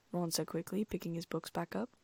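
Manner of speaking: usual speaking voice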